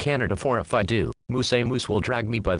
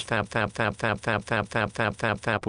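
fap sound; 3 dB quieter than usual. Acted. Imitated stroking noise.

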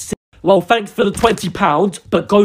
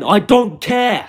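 ordinary delivery

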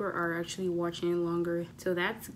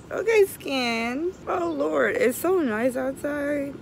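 higher, louder, slow and relishing; delighted; 8 semitones higher, 6 dB louder, much slower than usual. Real, not acted. Vlogger to viewers, thrilled by the sunny weather. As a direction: bask in the sun out loud.

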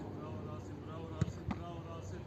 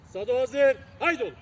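loud call; 2 semitones higher, 12 dB louder than usual. Real, not acted. Calling out a start.

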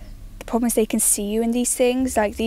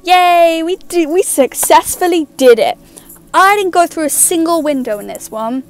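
loud, high, elated; excited celebration; 4 semitones higher, 5 dB louder than usual. Real, not acted. Gamer celebrating escape, loud and excited. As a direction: cheer it, thrilled to win.

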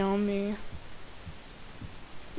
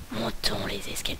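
scared whisper; 4 semitones lower, 7 dB quieter than usual. Real, not acted. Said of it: "c'est pas que j'ai peur".

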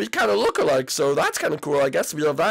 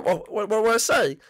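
ordinary talk, nothing marked

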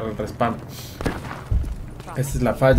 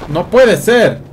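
frustrated shout; 7 semitones higher, 9 dB louder, much slower than usual. Real, not acted. Killed by grenade.

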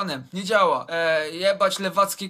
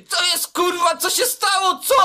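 high, loud enraged shout; 9 semitones higher, 6 dB louder than usual. Retold or imitated. Boy shouting at classmates over his wrecked churches. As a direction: yell it in sudden outrage. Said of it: "i krzyczy".

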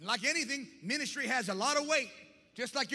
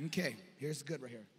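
quiet aside; 8 semitones lower, 8 dB quieter than usual. Real, not acted. Preacher suddenly drops voice.

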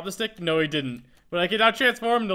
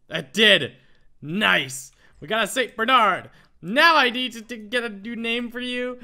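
loud, elated exclaiming, rising higher; so excited; 4 semitones higher, 7 dB louder, slower than usual. Real, not acted. Player bringing a Saint Bernard home, so excited. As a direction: exclaim loudly with real delight. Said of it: "I see a Saint Bernard and I get so excited".

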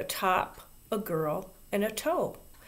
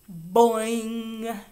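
louder, stretched-out boing; long drawn-out boing; 3 semitones higher, 5 dB louder than usual. A narrator's reading. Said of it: "uttered a long, drawn-out".

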